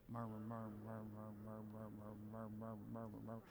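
soft, low murmur repeated. Real, not acted. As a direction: murmur it under your breath. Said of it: "say murmur out loud until I tell you to stop".

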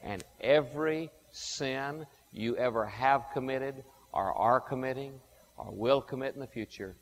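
ordinary talk

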